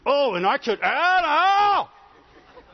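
a person talking themselves into anger; building rage. Retold or imitated. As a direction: work yourself up into a hammed-up fit. Angry person working themselves up.

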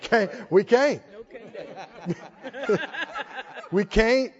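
"We can't" through chuckles; laughing modern version. Shown as a demonstration. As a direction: laugh while saying "We can't". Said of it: "What would we say?".

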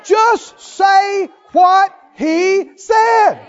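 slow, high, loud word-by-word shout; emphatic shout. Real, not acted. Preacher driving a command home to the congregation, emphatic slow shout. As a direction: shout it out one drawn-out word at a time.

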